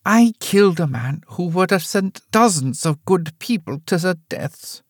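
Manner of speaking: mother admits the killing to daughter; old mother, cold confession. Acted. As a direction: say it plainly, no apology.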